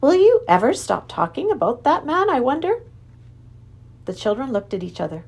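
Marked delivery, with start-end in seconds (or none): 0.0-2.8 high-pitched, lilting mild reproach; gently admonishing. A narrator's reading. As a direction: tease out a light scolding as a question. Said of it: "she admonished gently".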